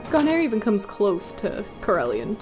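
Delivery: ordinary talk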